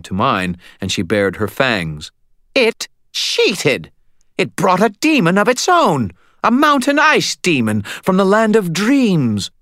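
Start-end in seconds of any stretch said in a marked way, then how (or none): 2.5-9.5 high, loud furious vampire cry; outraged. A narrator's reading. Vampire baring fangs at the wizard, high furious cry. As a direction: rage it out, pitched up high.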